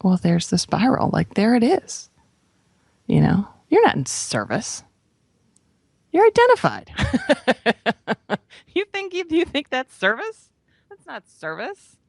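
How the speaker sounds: high, lightly voiced inner voice scolding; a knowing inner voice calling her out, mildly amused. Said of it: "a little voice popped in".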